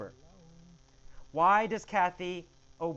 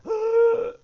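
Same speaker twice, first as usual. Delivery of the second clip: heart attack gasp; 12 dB louder than usual. Acted. Bob has a heart attack.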